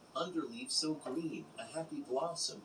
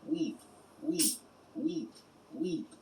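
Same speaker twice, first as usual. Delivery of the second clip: higher, louder little sweep calling out his trade; child's street cry; 5 semitones higher, 4 dB louder, slower than usual. Acted. Sold child calls out for chimney work, a high loud cry. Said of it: "my tongue could scarcely cry".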